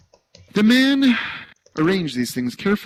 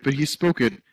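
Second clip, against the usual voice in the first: usual speaking voice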